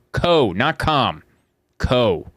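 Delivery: "Co" drawn out slowly and set apart; emphatic clarification. Real, not acted. Host reading sponsor's address to listeners, drawn-out "Co". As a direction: stretch "Co" against "com".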